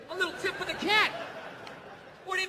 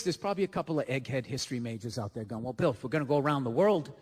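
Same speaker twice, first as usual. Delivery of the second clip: much lower, fast nerd voice; nerdy egghead; 9 semitones lower than usual. Acted. History major lecturing his friend Bill, low and fast. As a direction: rattle it off like a know-it-all history major.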